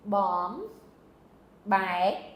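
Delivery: "Bom" and "bai" split by a long pause; syllable-by-syllable demo of "Bom, bai"; broken-up syllables. Shown as a demonstration. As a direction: say "Bom", stop, then "bai". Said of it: "break up between your syllables".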